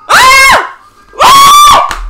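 two very high, loud screams; excited screams. Real, not acted. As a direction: scream at the near goal. Screams at shot on bar. (alaridos agudos)

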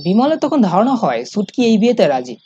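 plain reading voice

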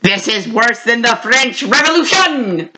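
slow, mock French accent for the skeleton; hammed-up French accent, drawn out; a cartoon French skeleton quoted in a light French accent. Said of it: "my favorite line from him is".

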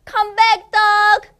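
high, loud call after the dog. Acted. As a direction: call the dog back from afar. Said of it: "calls the mom".

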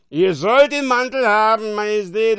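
loud, high, tipsy cry; boisterous drunk addressing royalty; drunken slurring. A narrator's reading. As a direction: slur it loudly to your king. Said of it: "lallte er".

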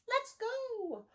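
rally everyone to set out; storybook badger shouting to go; eager shout. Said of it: "he shouts".

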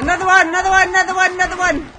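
loud, high chant; excited calling out. Real, not acted. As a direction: hype it up like a chant. Calling zombies on.

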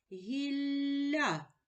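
«Гілля» з подовженим Л, вище; чітко вимовляє «Гілля»; careful word demo. Shown as a demonstration. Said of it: "побудуємо звукову модель". (протяжне «Гілля»)

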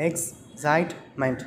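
slow "excitement" in three separate beats; syllable by syllable. Shown as a demonstration. Teacher counting syllables for children, "excitement" slowly split in three. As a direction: break "excitement" into ex, cite, ment with pauses. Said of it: "three syllables".

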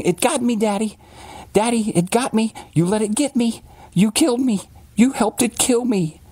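very high, thin imitation of a little girl; eerie high-pitched child pleading to Daddy; accusing dead child. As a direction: wail accusingly in a small child's falsetto. Dead daughter's eyes accusing her father.

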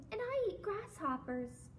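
high-pitched storybook emu; lightly voiced emu. A narrator's reading. Emu tells frog its food, light high voice. Emu answers in picture book.

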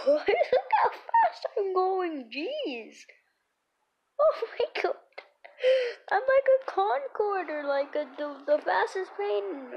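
high, loud thrilled gushing; excited. Real, not acted. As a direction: squeal with speed-rush delight. Thrilled by rocket speed.

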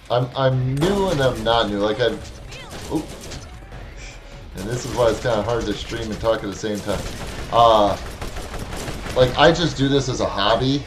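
usual speaking voice

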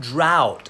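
drought with an "ow" vowel. Shown as a demonstration. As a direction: drought said with a clear "ow" and a falling tone. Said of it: "คำที่สอง". (คำว่า drought ออกเสียงอาว)

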